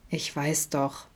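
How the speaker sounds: soft, weary sigh. Acted. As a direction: breathe it out, resigned. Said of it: "seufzte Adam". (leise, resigniert geseufzt)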